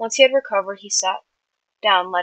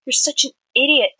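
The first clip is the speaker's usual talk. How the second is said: raised, higher exasperated shout; 6 semitones higher, 7 dB louder than usual. A narrator's reading. Friend scolding Tommy after a scare, shouted. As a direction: shout it loud and high. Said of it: "Tubbo shouted".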